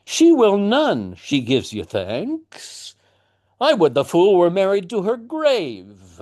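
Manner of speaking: wife siding with her husband, high and cutting; changed voice. Acted. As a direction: turn on the daughter for the husband's sake. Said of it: "you can hear the change in her voice".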